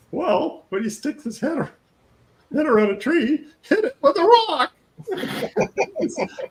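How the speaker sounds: high, laughing movie quote. Retold or imitated. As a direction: squeal it out through laughter. Quoting movie line, laughing.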